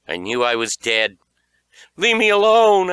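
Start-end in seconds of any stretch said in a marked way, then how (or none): 1.9-2.9 high, loud, desperate plea; terrified child begging to be left alone; frightened cry. A narrator's reading. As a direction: scream it at the monster. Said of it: "I cried".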